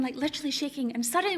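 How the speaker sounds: ordinary talk, nothing marked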